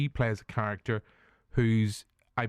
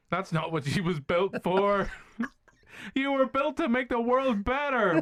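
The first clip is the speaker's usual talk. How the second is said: mock robot film line; 10 semitones higher than usual. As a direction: say it like a noble movie robot.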